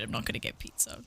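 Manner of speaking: low unvoiced whisper, fast; whispering a promise to herself; whispered aside. Real, not acted. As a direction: whisper it to yourself quickly.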